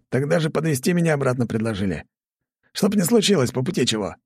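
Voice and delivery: cheerful young soldier, lightly voiced; a brisk, proud young guard. A narrator's reading. Guard Poloz voiced in dialogue.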